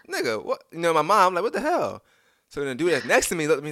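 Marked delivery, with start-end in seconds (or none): 0.1-0.6 high startled question; shocked. Retold or imitated. Retold shocked reaction. 1.4-2.0 high confused outburst; startled. Retold or imitated. Retold confused reaction.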